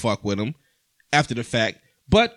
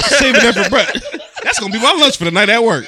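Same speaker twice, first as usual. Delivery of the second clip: laughing; 8 semitones higher, 7 dB louder than usual. Real, not acted. Joking about leftovers, laughing.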